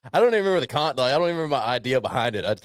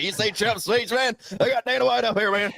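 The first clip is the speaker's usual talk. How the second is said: put-on higher, rowdy drunk buddy at 3am; drunk late-night caller; 10 semitones higher than usual. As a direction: play your tipsy self phoning a friend. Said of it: "I was like".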